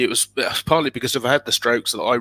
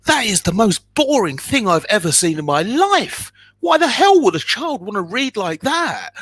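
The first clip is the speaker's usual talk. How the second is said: incredulous; 8 semitones higher than usual. Retold or imitated. Retold outraged reaction.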